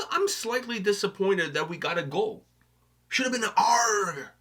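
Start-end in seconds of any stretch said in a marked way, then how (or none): 3.1-4.3 long drawn-out pirate "arr"; pirate growl. Acted. Wishing the track opened with one, pirate growl.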